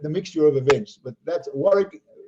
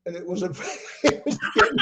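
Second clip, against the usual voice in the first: high, breaking up with real laughter; laughing hard; 5 semitones higher than usual. Real, not acted. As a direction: try to tell it but crack up. Breaks up at his own story.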